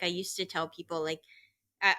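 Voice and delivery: ordinary talk, nothing marked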